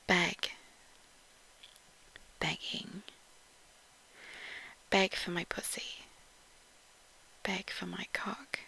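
soft breathy whisper, word by word; husky whisper reading naughty words one at a time; sultry whispered word list. Acted. Reads dirty word list.

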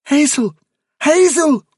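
high, loud call down the hole. Acted. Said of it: "He called".

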